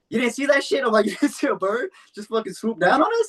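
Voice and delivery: high, stunned disbelief; can't believe he missed it; incredulous. Retold or imitated. Disbelief after the bird swoop.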